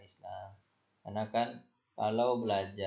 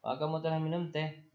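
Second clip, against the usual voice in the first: higher, fast, lively mother's question; quick high-pitched motherly question; mother asking; 6 semitones higher, faster than usual. Acted. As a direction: ask it brightly as the mum would. Mother asks daughter.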